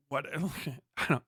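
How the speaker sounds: ordinary talk, nothing marked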